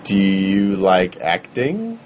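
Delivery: low, slow and oddly earnest; slow, low costumed fan. Retold or imitated. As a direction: draw it out in a low voice, deadpan. Voicing fan dressed as Leia.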